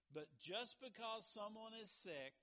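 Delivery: ordinary talk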